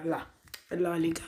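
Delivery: normal talking voice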